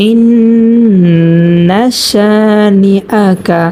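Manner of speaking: ইন্না শানি আ কা drawn out in tajweed chant; held nasal on ইন্না, long a; slow recitation. Shown as a demonstration. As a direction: recite each syllable slowly and melodically. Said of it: "যেমন".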